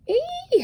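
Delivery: high yelp of pain; a sudden high howl. A narrator's reading. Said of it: "howled Mister Magee".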